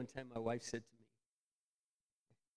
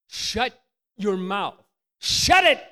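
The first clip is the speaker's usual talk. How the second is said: slow then sudden loud high shout; mock self-command; 8 semitones higher, 12 dB louder, much slower than usual. Shown as a demonstration. Preacher telling himself to shut up, shouting. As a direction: bark it at yourself. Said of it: "to shut up".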